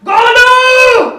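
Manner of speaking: long, drawn-out high bellow; furious shout. Acted. Father bellows after his runaway son, furious drawn-out shout. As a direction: yell the name and hold it.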